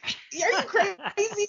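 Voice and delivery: high, drawn-out mock disbelief; incredulous kid. Student to teacher on being told to speak up, incredulous. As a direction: imitate a child who can't believe the advice. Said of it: "he looked at me like".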